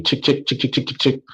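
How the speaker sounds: soft clicky typing noise; quiet imitated tapping on a phone; typing sound effect. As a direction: mouth the tap of keys softly. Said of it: "She typing me".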